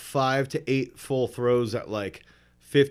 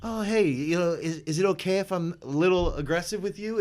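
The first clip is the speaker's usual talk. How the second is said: lightly higher, overly polite tone; mock polite asking; 5 semitones higher than usual. Athlete mocks a polite coach asking permission. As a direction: play the gentle coach who never existed. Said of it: "there was no".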